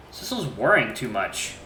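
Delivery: ordinary talk, nothing marked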